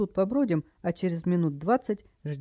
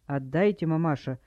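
ordinary delivery, nothing marked

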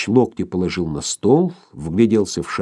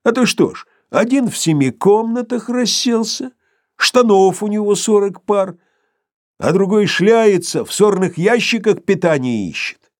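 high-pitched tipsy holding forth; Sharikov, talkative after vodka; 11 semitones higher, 3 dB louder than usual. A narrator's reading. Sharikov explains his simple scheme after vodka, talkative. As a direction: rattle out the grievance in a raised, high voice. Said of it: "становясь словоохотливее после водки".

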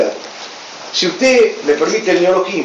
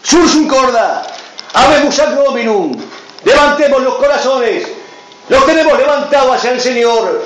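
higher, rising liturgical call; booming balcony speech; 5 semitones higher than usual. Acted. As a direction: lift it like a priest's call.

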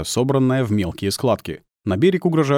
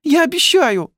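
put-on very high, loud child's promise; pleading little boy; 6 dB louder than usual. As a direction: promise Dad earnestly in a tiny high voice. Boy promises while wiping eyes.

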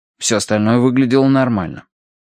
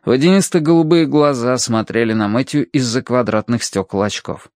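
usual speaking voice

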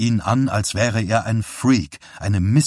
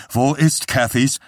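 higher, abrupt demanding question; brusque; 4 semitones higher than usual. A narrator's reading. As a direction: demand the answer curtly. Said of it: "fragte Johnny schroff". (schroff gefragt, mit erhobener Stimme)

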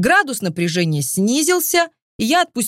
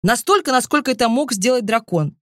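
plain reading voice

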